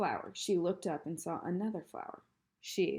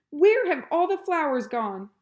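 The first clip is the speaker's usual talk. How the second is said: loud, high outraged shout; furious shout over the ruined flowerbed; 9 semitones higher, 13 dB louder than usual. A narrator's reading. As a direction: bellow it in disbelief. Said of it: "shouted Eugenia".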